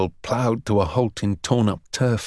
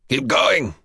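yelled. A narrator's reading. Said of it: "Ustinov yelled".

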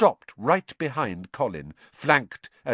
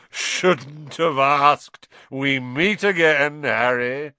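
loud, slow, higher hearty boom; thundering joviality; 6 semitones higher, 6 dB louder, much slower than usual. A narrator's reading. Lockhart greeting Harry, thundering jovially. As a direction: boom it out jovially, stretching the words. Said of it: "and thundered jovially".

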